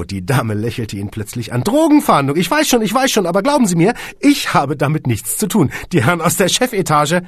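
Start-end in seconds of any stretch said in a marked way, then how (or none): 1.6-7.2 high, loud, flustered disclaimer; flustered protest. A narrator's reading. Receptionist assuring supposed drug investigator she's innocent, flustered. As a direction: protest innocence loudly, pitched well up. Receptionist hastily denies involvement.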